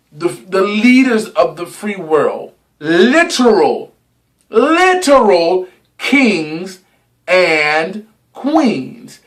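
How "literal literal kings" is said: slow, stretched literal kings; drawn-out emphasis. Real, not acted. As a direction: lean hard on literal, slowly. Dramatic emphasis.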